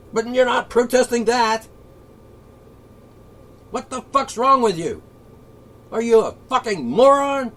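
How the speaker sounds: loud, high furious rant at the protesters; ranting and raving. Real, not acted. Ranting at protesters, furious and loud. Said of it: "Yes, I'm ranting and raving again".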